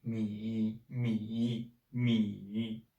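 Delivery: "mi" held long on a low dipping tone; third tone. Shown as a demonstration. Teacher practises pinyin with learners, dipping third tone. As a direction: say "mi" in Mandarin third tone, down then up. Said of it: "third tone".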